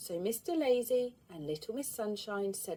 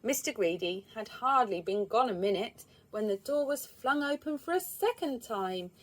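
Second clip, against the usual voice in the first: ordinary delivery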